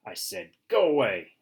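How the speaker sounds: loud, high urgent bark. Acted. Dog yells at the squirrel to leave, loud urgent bark. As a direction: bark it out, drive him off. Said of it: "I bark with more urgency".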